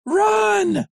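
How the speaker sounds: very high, loud shout; panicked shout. Acted. Rambo shouting at Vic to run, very high and loud. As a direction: yell at him to flee now. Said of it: "Rambo cried".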